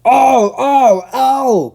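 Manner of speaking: very high, loud wailing cry; van crying out. Acted. The van crying out as it is bumped, wailing. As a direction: squeal out in pain. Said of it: "cried the van".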